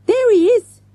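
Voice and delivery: high, loud excited scream. A narrator's reading. Girl spotting the gunman by the metro, screamed. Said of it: "screamed Avril".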